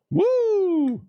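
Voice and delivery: sudden very high whoop of relief; mock cheer. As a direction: whoop like you just got lucky. Mock cheer after rejection.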